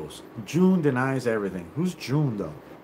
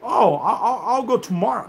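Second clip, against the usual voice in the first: mocking the excuse; 6 semitones higher than usual. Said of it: "you would have been like".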